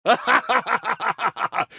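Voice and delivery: high, maniacal triumphant laugh; evil cackle. Acted. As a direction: cackle as the plan succeeds. Villain triumphs as machine starts.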